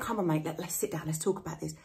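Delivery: gentle coaxing. Retold or imitated. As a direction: ease a worried mate into talking, softly. Coaxing a troubled friend.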